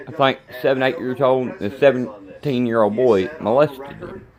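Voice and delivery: normal talking voice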